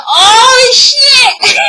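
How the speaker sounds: high, gleeful shocked exclamation; shocked delight. Retold or imitated. Reacts to the surprise lyric.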